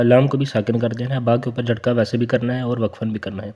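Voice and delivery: usual speaking voice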